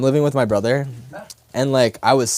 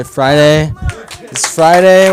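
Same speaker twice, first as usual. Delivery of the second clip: louder hype on Friday; rally the room; excited hype; 3 semitones higher, 7 dB louder, slower than usual. Real, not acted. Hyping the crowd.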